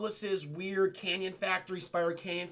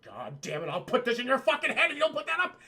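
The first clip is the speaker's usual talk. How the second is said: mock angry president; 4 semitones higher, 3 dB louder than usual. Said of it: "Ulysses Grant's like".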